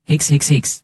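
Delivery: high whimpering mock sobs; childish fake sobbing. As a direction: sniffle it out like a crybaby. Fake crying to avoid injection.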